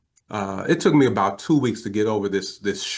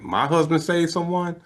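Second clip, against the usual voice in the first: higher-pitched retold disbelief; surprised question; 8 semitones higher than usual. Older woman answers an unexpected call, imitated surprise. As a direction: query it as the startled wife.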